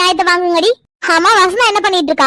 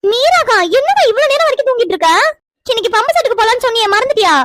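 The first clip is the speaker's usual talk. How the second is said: higher-pitched, insistent complaint; indignant wake-up call; 5 semitones higher than usual. Acted. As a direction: nag a sleeper to get up. Isai scolds sleeping cousin awake. (குறைபடும் உயர்ந்த குரல்)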